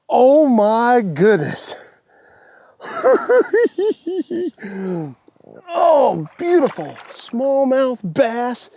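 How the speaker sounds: slow, drawn-out wonder then a high laugh; real joy; awed delight. Real, not acted. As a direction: savor it. Admiring a big fish.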